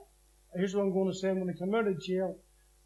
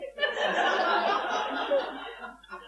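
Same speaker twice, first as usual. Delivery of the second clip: tearful laugh. Real, not acted. Overcome while retelling prison moment.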